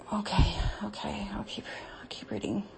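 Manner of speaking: breathless whisper; whisper, flustered and distracted. Real, not acted. Whispering, trying to continue.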